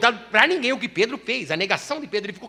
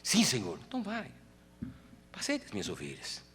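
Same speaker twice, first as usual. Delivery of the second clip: much lower and softer gentle dialogue; tender, hushed; 10 semitones lower, 9 dB quieter than usual. Acted. Jesus asking Peter if he loves him, tender and hushed. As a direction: speak it tenderly, like a close secret.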